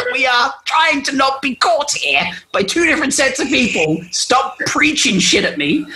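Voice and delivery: high-pitched, exasperated telling-off; hammed-up frustrated rant; mock angry scolding. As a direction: scold them, stretching the final order. Acted scolding of the preacher.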